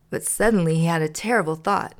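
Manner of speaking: usual speaking voice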